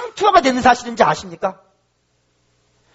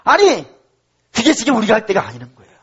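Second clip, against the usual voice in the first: normal talking voice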